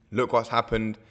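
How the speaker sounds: normal talking voice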